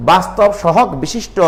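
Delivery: ordinary delivery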